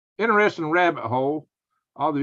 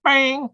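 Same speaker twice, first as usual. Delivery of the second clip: mock gunshot; 9 semitones higher, 4 dB louder than usual. Real, not acted. Killing the browser tab.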